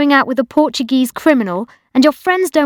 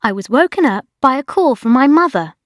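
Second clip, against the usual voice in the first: plain reading voice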